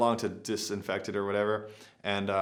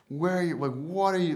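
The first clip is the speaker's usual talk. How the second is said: retold angry scolding; 7 semitones higher than usual. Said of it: "just so pissed off".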